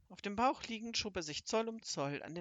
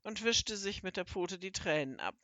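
ordinary delivery, nothing marked

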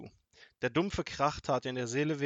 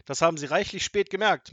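loud, raised mocking reply; laughing taunt; 5 semitones higher, 10 dB louder than usual. A narrator's reading. Robber found out by grandmother, loudly gloating. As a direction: gloat at her slowness. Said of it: "Hotzenplotz radelte lachend weiter".

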